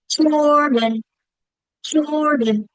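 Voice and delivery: Jordan loud and high, long first syllable; clapped first-syllable stress. Shown as a demonstration. Teacher clapping a name to show accent, Jordan punched at the front. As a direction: clap out Jordan, hardest on "Jor". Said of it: "I can clap it".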